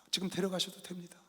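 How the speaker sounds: a hushed prayer of letting go; whispered prayer. Retold or imitated. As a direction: whisper it, surrendering. Praying to God for son. (속삭이는 기도)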